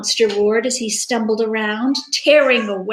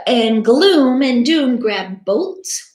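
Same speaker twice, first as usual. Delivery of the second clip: plain reading voice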